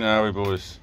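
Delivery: normal talking voice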